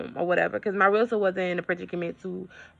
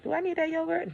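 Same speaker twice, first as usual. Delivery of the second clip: self-questioning; 10 semitones higher, 6 dB quieter, faster than usual. Retold or imitated.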